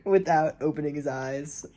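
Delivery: usual speaking voice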